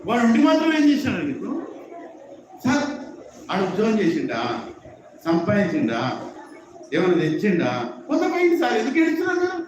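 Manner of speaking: rising tactless argument. Acted. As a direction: build to a high-pitched protest. Tactless son arguing with father.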